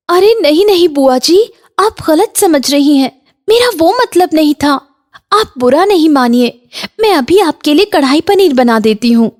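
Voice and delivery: high, hurried-to-placate bahu voice; appeasing daughter-in-law. Acted. Bahu calming offended aunt, high apologetic pleading.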